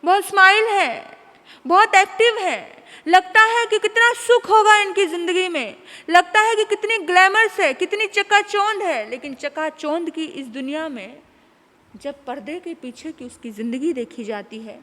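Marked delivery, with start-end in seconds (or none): 0.0-9.1 loud, higher emphatic sermon voice; booming preacher's warning; forceful preaching. Acted. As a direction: drive the lesson home.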